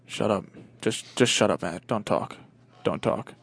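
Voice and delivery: low, quiet, tense order; curt silencing. Acted. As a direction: shut him down under your breath. Driver silencing friend in story.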